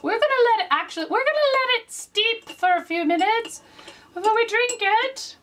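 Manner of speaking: high fancy put-on voice; high mock-posh tea talk. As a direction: play a prim tea-sipping aristocrat. Posh tea voice, pitch jump.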